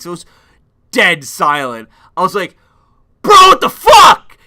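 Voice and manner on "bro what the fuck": sudden shocked shout, much higher and louder; really loud. Retold or imitated. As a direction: blurt it out loud in the dead-silent garage. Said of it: "I said really loud".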